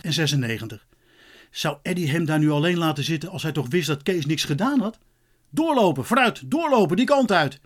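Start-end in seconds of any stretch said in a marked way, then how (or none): 5.5-7.6 high, brisk policeman's commands; raised-pitch commanding call; called-out orders. A narrator's reading. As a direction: call out to move people along. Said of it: "riep de agent".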